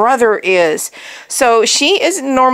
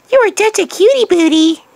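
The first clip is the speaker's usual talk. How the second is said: high, louder cooing; 7 semitones higher, 3 dB louder than usual. Real, not acted. Owner gushing to her puppy, high baby talk. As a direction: gush over the puppy up high.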